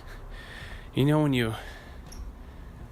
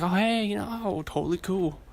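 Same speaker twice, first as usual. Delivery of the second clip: high-pitched fake-casual bluff; hammed-up nonchalance; playing it cool; 10 semitones higher than usual. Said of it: "Just play it cool".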